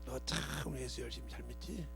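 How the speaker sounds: 낮고 조용하게 아들을 부르는 아버지; 조용히 달래듯 묻는 아버지 흉내; father voiced low and soft. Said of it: "우리 아버님이 또". (아들에게 조용히 묻는 아버지 목소리)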